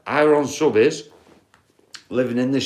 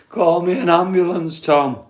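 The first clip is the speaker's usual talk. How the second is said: higher, strained mock panic; hammed-up scream; 5 semitones higher than usual. Overdosed housemate pleads with Tom, hammed-up panicked call. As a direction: ham up the housemate begging for help. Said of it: "The guy was screaming".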